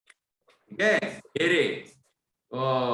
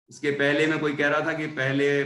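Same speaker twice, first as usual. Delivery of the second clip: normal talking voice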